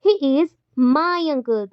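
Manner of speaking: MY stretched long after a short break; very slow, "my" lengthened; stress on MY. Shown as a demonstration. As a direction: hold back, then draw out "my". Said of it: "तो my बोला गया".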